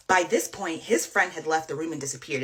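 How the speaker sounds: plain reading voice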